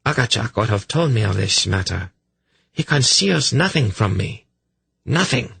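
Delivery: mildly indignant, sure of her standing; insistent priestess. A narrator's reading. Voices the haughty priestess.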